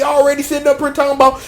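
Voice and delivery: ordinary delivery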